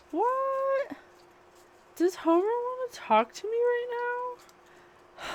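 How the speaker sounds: startled. Real, not acted. Medium surprised that Homer is speaking, startled high voice. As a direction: jump up in pitch, caught off guard.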